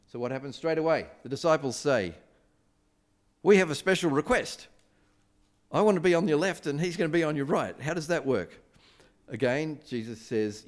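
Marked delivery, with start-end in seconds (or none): 3.4-8.6 higher and louder, pushy and eager; eager disciples' request. Retold or imitated. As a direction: ask like a bold disciple wanting the best seat. Disciples make their eager request.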